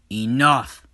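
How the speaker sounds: loud thundering shout; booming command. Acted. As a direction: stop the fight with one loud word. God's voice booms from heaven.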